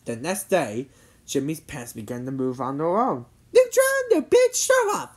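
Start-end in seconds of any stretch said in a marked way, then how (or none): 3.5-5.1 put-on high, loud rival girl voice; accusing girl character. A narrator's reading. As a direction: accuse him in a piercingly high voice. Cindy accusing Jimmy in story.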